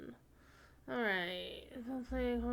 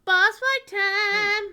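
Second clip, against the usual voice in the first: high, slow, loud announcer yell; hype shout; 10 semitones higher, 15 dB louder, slower than usual. Shown as a demonstration.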